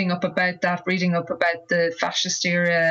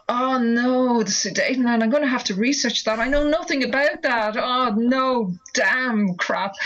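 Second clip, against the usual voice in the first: higher-pitched inner panic, played up for the retelling; mock dismay; 5 semitones higher than usual. Writer recalls realising she must research the Sudetenland, mock dismay. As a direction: groan inwardly at homework you never wanted.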